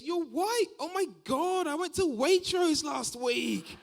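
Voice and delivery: high, loud gushing; mock over-excitement. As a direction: squeal it like a thrilled over-keen fan.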